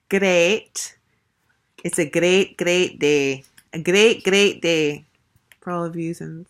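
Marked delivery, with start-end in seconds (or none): 0.1-5.1 loud, badly faked Scottish accent; mock Scottish, punched out loud; terrible Scottish accent. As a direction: put on a hearty bad Scots accent. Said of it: "Scottish accent".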